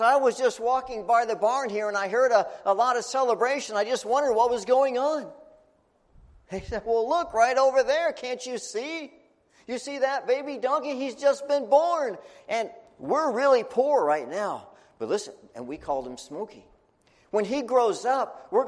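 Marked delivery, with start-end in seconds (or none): 0.0-5.3 curious visitor. Acted. High priest at barn door. 6.8-14.7 high, loud and eager; proud excitement. Acted. Dad shows the priest the baby donkey, high and eager.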